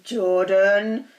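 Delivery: loud, drawn-out warning call; stern, loud reprimand; growled warning. A narrator's reading. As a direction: growl the name as a telling-off.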